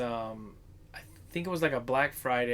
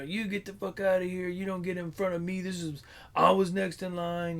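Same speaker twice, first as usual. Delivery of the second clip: imitating an irate customer, a little higher; mock angry customer yelling at a cutter; 5 semitones higher than usual. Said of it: "the guy gets irate".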